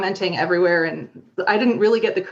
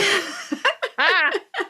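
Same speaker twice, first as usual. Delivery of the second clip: laughing; 10 semitones higher than usual. Real, not acted. Laughing at the story.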